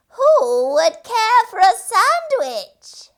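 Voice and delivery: high, slow far-off call; distant sing-song call; faint distant voice. A narrator's reading. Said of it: "a faint voice far in the distance".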